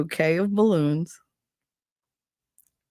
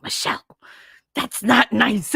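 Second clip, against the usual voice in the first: 1 scolding mother; 6 semitones higher than usual. Retold or imitated. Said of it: "my mother was like".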